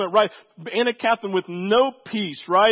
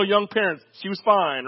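ordinary talk

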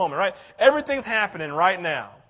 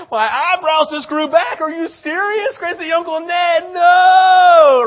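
high, loud protesting shout; outraged kid; 10 semitones higher, 12 dB louder, slower than usual. Acted. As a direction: refuse the visit at full voice. Kid protesting Uncle Ned visit.

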